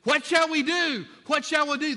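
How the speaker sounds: high, loud anguished plea; desperate crying out. Acted. Crowd crying out to Peter in anguish, loud plea. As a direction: cry it out like a convicted crowd. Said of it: "they stopped him and said".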